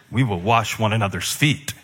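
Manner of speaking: ordinary delivery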